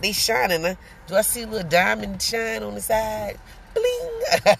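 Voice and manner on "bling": playful bling. Pretend. Playful sparkle sound on teeth.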